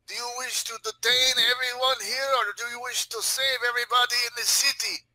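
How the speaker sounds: high, imploring challenge; indignant Russian character. Pretend. Russian urging the sergeant to let him go, indignant.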